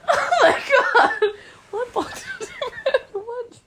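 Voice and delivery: high, loud, slow words through laughter; laughing. Real, not acted. Child reacting to mum's story, laughing hard. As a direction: crack up mid-question.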